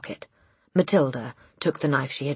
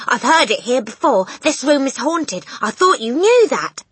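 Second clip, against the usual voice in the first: urgent insistence; 10 semitones higher, 8 dB louder than usual. A narrator's reading. Girl stokes family's ghost fear.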